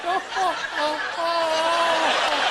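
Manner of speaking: high, slow drawn-out fake oh; mock fumbling. Rider in the elevator fakes trying for the door, slow mocking oh. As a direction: pretend to try, hamming it up.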